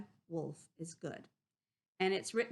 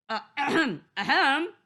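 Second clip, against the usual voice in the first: loud polite throat-clearing; polite cough; 7 semitones higher, 10 dB louder than usual. Acted. Wolf politely coughs to get Missus Chicken's attention. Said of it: "coughed politely".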